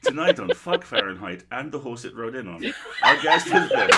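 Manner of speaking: loud laughing host voice, hammed-up; mock talk show intro. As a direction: announce it like a cheesy TV host.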